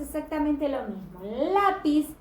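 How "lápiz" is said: "Lápiz" louder and higher on "Lá"; stress on first syllable. Shown as a demonstration. Teacher repeating the example, "Lápiz" with strong first syllable. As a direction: punch the "Lá" of "Lápiz". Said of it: "reconozco que la sílaba tónica es la".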